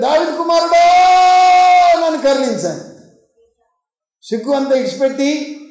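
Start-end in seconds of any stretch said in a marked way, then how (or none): 0.0-2.8 high, long-held shouted plea; louder shouting. Retold or imitated. Silenced blind man cries harder to the Lord, shouting loudly. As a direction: imitate a desperate cry, stretching the name out. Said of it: "మరీ గట్టిగా అరచడం మొదలుపెట్టింది".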